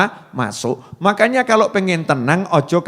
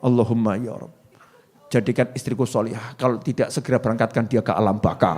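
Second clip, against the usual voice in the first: low, soft mock prayer, rushed at the end; muttered prayer of a tired husband; husband's mock prayer; 7 semitones lower, 6 dB quieter, faster than usual. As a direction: pray under your breath like a fed-up husband.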